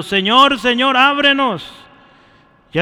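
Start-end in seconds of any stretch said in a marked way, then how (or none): 0.0-1.7 raised, higher voice crying out to be let in; pleading cry. Retold or imitated. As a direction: call out like someone locked outside begging. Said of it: "afuera gritando".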